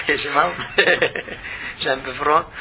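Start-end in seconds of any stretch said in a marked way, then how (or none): 0.1-0.5 laughing through the words; amused, half-laughing aside. Real, not acted. Laughs mid-verse after stumbling. 0.5-1.8 soft unvoiced laugh; real breathy chuckle. Real, not acted. Laughter after tongue-twisting repeats.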